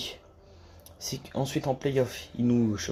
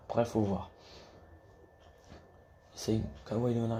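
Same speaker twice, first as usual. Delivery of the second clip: ordinary talk, nothing marked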